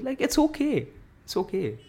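put-on high, lilting "it's okay" as if soothing a child; sing-song reassurance. Vlogger soothing women who brag about eating, high sing-song coo. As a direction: coo it sweetly, pitch way up.

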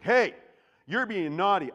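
loud, high mock scold; mock telling-off. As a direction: call out a friend in a hammed-up scold. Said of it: "I go up to Tanner and be like".

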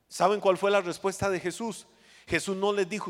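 ordinary talk, nothing marked

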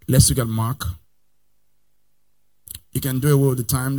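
ordinary talk, nothing marked

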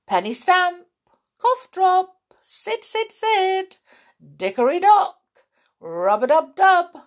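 series of high-pitched calls; calling out. A narrator's reading. Toys trying different calls on the mouse, calling out. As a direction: call each name out playfully. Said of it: "came up at different calls".

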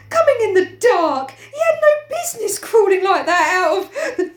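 high, shrill, hammed-up protest. Piggy blaming Simon to Ralph, shrill. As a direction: send the voice up sharp and piercing. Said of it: "His voice shrilled again".

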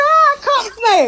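high, slow, baffled repeat; puzzled echo. Retold or imitated. Echoing his daughter's odd insult, slow baffled high voice. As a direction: repeat it back, stumped. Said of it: "I said".

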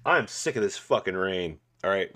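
ordinary talk, nothing marked

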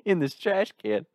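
put-on high southern accent, lisping outrage; J B, lisping Southerner. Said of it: "had this southern accent with a lisp".

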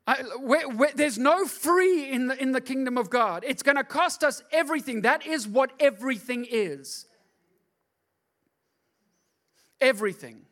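loud, high, driving sermon; full preaching. Acted. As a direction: preach it hard to the congregation.

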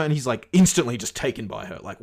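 ordinary talk, nothing marked

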